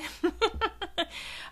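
high, soft giggle; real giggle at herself; short run of high, light laughter. Real, not acted. Laughs after wrapping up her rant.

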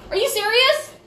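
exasperated mom, high, disbelieving; high incredulous. Retold or imitated. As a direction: squeak it out, stunned and fed up. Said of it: "it's like".

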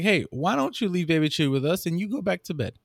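ordinary delivery

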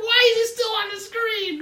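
high, loud mock wail through tears; crying child. Remembering crying at Jafar as a child, high mock sobbing wail. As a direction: whine it like a sobbing little kid. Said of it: "I was like".

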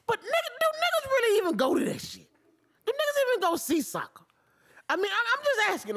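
high mock disbelief at soccer; incredulous high voice. Real, not acted. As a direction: squeak out the question like it's absurd. Mocking soccer fans incredulous.

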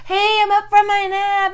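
high, bright sing-song announcement; hammed-up cheerful call; cheery mock greeting. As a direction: chirp it like you just woke up. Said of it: "she's like".

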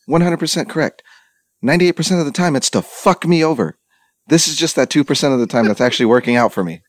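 high-pitched, insistent comeback; retold rant, hitting each point high; emphatic agreement. Retelling comeback to friend about rare luck, high and emphatic.

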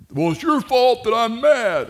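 high, loud snapping parent voice; mock angry parent. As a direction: snap it at the kid like a fed-up parent. Angry parent blaming the kid.

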